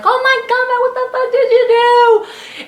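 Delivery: high, loud cry of pain and anger; pained yelling. Retold or imitated. Woman in labor yelling at the nurses in pain. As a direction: yell it out as the pain hits. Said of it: "and I was like".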